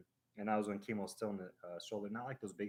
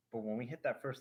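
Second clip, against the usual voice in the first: normal talking voice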